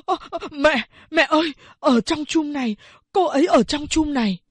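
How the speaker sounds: high, breathless startled exclamation; a son frightened by what he sees; startled cry. A narrator's reading. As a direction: blurt it out, spooked by the water jar. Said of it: "thốt lên".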